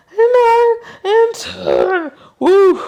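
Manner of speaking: very high, loud strained count; straining cheer. Real, not acted. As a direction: push out the last two. Straining final reps cheer.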